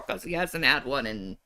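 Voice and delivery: usual speaking voice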